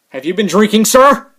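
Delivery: loud, pitch rising on the questioning; mock police officer. Vlogger as cop with flashlight, loud mock interrogation. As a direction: play an officer interrogating a suspect.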